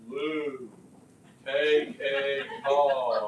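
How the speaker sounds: very slow, drawn-out words; slow motion. Shown as a demonstration. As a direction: stretch every syllable in slow motion. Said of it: "I'm going slow motion".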